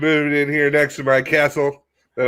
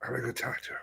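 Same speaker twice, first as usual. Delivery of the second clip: hushed conspiratorial whisper; 20 dB quieter, much faster than usual. Pretend. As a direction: breathe it out, no voice. Sneaking up to the zebrafish.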